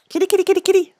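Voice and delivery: high sing-song call to the cats; high-pitched kitty call. Real, not acted. Calling the cats.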